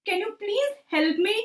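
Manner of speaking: high timid little dragon voice; pleading dragon. Acted. Dragon knocks on owl's tree, high timid plea. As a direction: knock shyly and beg.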